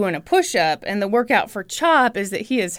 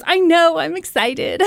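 higher, louder gush breaking into a laugh; mock excitement, raised pitch; excited anchorwoman; 6 semitones higher, 3 dB louder than usual. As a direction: gush like a thrilled TV co-host. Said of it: "she's just so excited".

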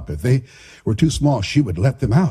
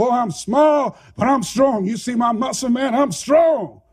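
put-on higher, eager kid voice, shouting it; boastful kid; 8 semitones higher than usual. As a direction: insist you're strong, puffed up. Boy insisting he is strong.

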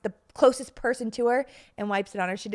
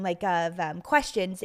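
ordinary delivery

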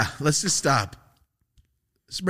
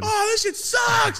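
high, slow mock shout; complaining shout; slower than usual. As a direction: yell it in disgust, pitched way up.

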